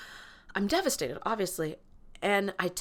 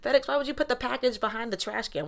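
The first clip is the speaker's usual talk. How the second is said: exasperated, higher mock scolding; 5 semitones higher, 3 dB louder than usual. Speaking to FedEx about the hidden package: mock exasperated scolding. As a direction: scold FedEx like a naughty kid.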